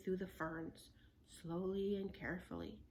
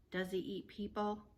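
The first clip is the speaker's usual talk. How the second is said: ordinary delivery, nothing marked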